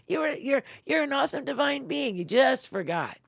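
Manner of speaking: high, loud, excited heavenly greeting; cheerful voice from the pearly gates; excited divine voice. Acted. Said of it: "he's gonna find out".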